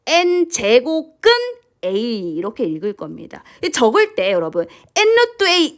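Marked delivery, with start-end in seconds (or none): 0.1-2.3 slow, segmented read-along for students; teacher modelling the term slowly; read-along demo. Shown as a demonstration. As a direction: read "N 제곱근 A" slowly, pausing before A. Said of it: "같이 따라 읽어볼까요?".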